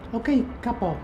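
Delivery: high thin "ok, capo"; the driver's squeaky reply; high-pitched cabbie answer. Retold or imitated. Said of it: "me dice de vuelta".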